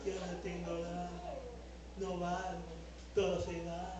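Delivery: a touch of a depressed pastor; mock despair. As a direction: sigh it out like you've given up.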